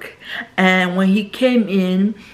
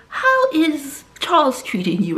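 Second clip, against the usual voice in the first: higher, put-on posh British voice; British accent; 6 semitones higher than usual. Soap character asking how Charles is treating you, mock British accent. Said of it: "That, that British accent".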